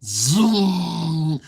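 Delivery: loud, drawn-out "zoom"; engine noise imitation. Telling how the car started, loud engine noise. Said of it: "Then he turns the key".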